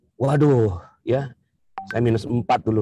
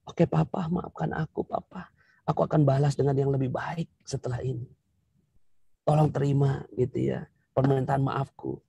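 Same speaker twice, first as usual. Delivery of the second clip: much softer, tender pleading wife; wife softly begging her husband's forgiveness; soft humble apology; 7 dB quieter than usual. Acted. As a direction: apologize gently and humbly to him.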